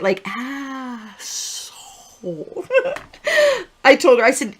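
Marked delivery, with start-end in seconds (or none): 0.3-1.7 put-on slow, soft, drawn-out baby voice saying "Asshole."; baby repeating a swear. Imitating the Meet the Fockers baby, slow and soft. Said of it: "the way he said it". 2.2-3.0 high giggle. Real, not acted. Laughing after the swear.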